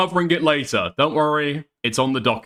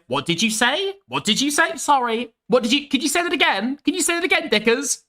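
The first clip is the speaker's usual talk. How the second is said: high, mocking southerner asking him to repeat; 8 semitones higher than usual. Mimicking southerners who can't understand him, mocking high. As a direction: squeak it like an annoying southerner. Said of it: "people saying".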